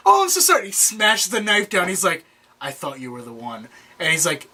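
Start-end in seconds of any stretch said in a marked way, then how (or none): 0.1-0.7 very high, panicky sorry; mock-terrified, pitched way up; imitated frantic apology. Frantic apology in retold scene. 2.6-3.7 low, hushed imitation of a killer; acted-out cold letdown; quiet disappointment. Killer's disappointed quote after smashing knife.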